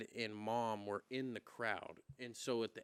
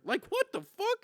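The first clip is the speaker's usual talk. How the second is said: much higher exclamation; amazed disbelief; 7 dB louder than usual. Real, not acted. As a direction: squeal it in disbelief. Amazed at Khabib's childhood training.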